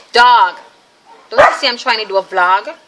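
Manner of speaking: real annoyed shout at the dog; telling off the dog during filming, loud and sharp; scolding the dog. Real, not acted. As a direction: snap at the interrupting dog, loud. Scolding dog mid-recording.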